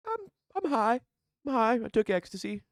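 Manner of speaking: high, halting admission; sheepish confession. Retold or imitated. Admitting to mom he took ecstasy, high and sheepish. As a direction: own up in a small high voice.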